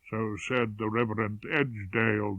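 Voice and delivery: ordinary delivery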